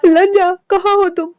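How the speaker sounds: desperate calling out. Acted. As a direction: shout the name searching. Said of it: "आवाज लगाता है".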